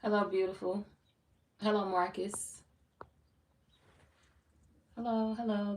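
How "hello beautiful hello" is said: normal talking voice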